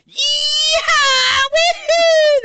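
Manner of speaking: very high, loud yeehaw shouts; hammed-up wedding guests hollering; mock cowboy yell. Retelling a Southern wedding, wild cowboy hollers. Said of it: "everyone's like".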